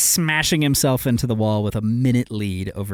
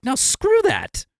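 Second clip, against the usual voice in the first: high, slow mock protest; mock outraged refusal; slower than usual. Refusing to hand Max more titles, high and slow. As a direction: squeal No, screw that up high and drawn out. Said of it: "I'm like".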